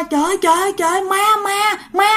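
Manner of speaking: high panicked cries of ghost; terrified cry. Acted. Sister-in-law crying out to the family, terrified. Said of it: "thấy bà này cứ la la".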